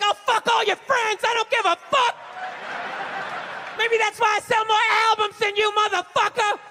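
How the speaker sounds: hammed-up shrieking insult; mock screaming girlfriend. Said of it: "screaming in his ear some crazy female shit".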